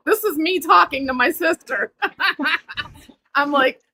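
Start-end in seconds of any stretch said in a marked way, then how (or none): 2.0-2.8 high, light laugh; self-conscious laugh. Real, not acted. Caller telling how she spoke as mother, laughing. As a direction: laugh at your own tale. Laughs after retelling talk to sister.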